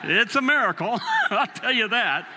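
excited. Real, not acted. Preacher telling the congregation the punchline, excited. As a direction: sell the miracle with a laugh.

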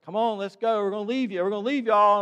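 put-on loud, high call to hurry a kid; calling out to a child. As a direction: call her back to the car, half-joking. Calling granddaughter back to car.

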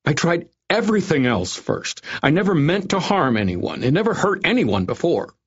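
frantic pleading. A narrator's reading. Frightened doctor pleads innocence.

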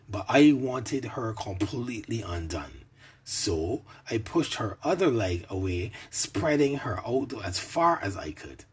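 usual speaking voice

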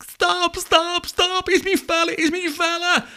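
put-on very high, shrieking girl caricature; mock screaming. Girl in back seat begs driver to stop, imitated screaming. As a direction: shriek frantically in a put-on falsetto. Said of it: "She's screaming at me to stop".

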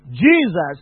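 loud, high defiant challenge; furious demand to be shown; angry shout. Retold or imitated. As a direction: hurl it at heaven in rage. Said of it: "I shouted and said".

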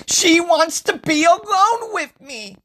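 high, loud, giddy insistence; thrilled squeal. Acted. Usuke insisting to Iridis she wants him, thrilled.

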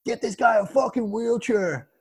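put-on slow, drawn-out American accent. American soldier calling for a wheelchair, hammed-up American accent. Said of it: "this is my American accent".

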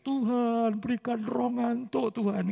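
high, loud, drawn-out mock plea; imitated prayer shout. Preacher imitating sleepy friends begging God, high mock prayer shout. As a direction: cry out slowly like a desperate intercessor. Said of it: "Sampai teman-teman teriak".

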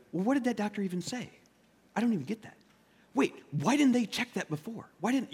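high, nervy retold complaint; agitated questioning. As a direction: imitate a worried relative grumbling at the doctors. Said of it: "we're all agitated, we're all, you know, a little nervous".

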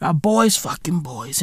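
ordinary talk

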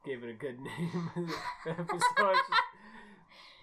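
ordinary delivery

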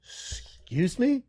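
very drawn-out, high rising question; retold incredulity. Rider answering a yelling lady, incredulous. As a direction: stretch it out in mock disbelief. Retold disbelief at being yelled at.

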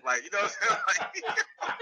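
ordinary talk

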